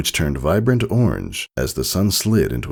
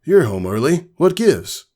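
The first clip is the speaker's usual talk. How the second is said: surprised; 12 semitones higher than usual. A narrator's reading.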